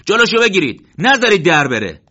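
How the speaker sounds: loud high call to stop him; shouting. Acted. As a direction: shout across the camp. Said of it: "داد زد".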